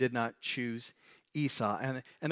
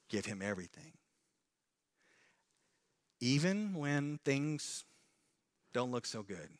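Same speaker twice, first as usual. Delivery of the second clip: soft earnest appeal; 3 semitones lower, 8 dB quieter than usual. Real, not acted. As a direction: lean in gently. Tender pastoral appeal.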